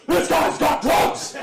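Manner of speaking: high, loud, slow yelled bark; barking dog voice. Retold or imitated. A comic telling how the police dog barked, voicing it as a high, loud yelled bark. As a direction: shout it like a dog that lost its mind. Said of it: "This dog was like".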